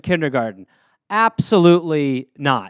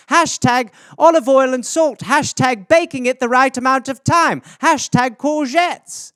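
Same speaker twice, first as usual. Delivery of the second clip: higher, proud mock-mom voice, the last hashtag slowed; imitating mom's posts; 6 semitones higher, 4 dB louder than usual. Mother posting about her courgettes, in a proud higher mock voice. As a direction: play a pleased mother bragging about cooking. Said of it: "It would just be that all".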